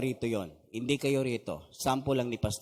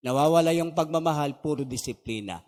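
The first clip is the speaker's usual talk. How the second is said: normal talking voice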